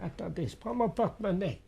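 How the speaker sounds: distant exchange off the phone; from another room. Acted. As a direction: talk well away from the receiver. Both speak away from phone. (échange lointain hors du combiné)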